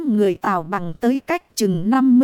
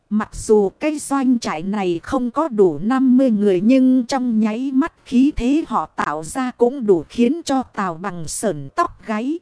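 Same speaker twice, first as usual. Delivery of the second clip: ordinary delivery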